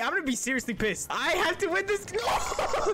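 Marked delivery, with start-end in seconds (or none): 1.1-2.9 high, urgent cry; desperate outburst. Real, not acted. Player fighting for the last mini, desperate high cry.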